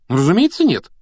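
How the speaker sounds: high, loud surprised reply. A narrator's reading. Hermione answers Harry's doubt, surprised. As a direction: sound amazed at the very question. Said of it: "удивилась Гермиона".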